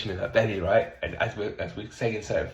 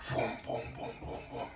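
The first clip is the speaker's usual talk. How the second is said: vrooms sinking softer to a breath; fading vroom sounds; 8 dB quieter than usual. Retold or imitated. Coach retelling client's throbbing, vrooms fading to nothing. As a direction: let each vroom die away.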